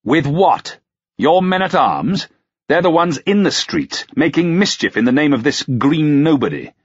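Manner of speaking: high-pitched, scornful retort; sneering. A narrator's reading. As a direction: throw it back with a sneer. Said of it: "turned a sneer on the constable".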